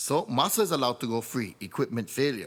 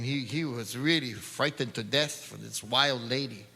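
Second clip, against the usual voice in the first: ordinary delivery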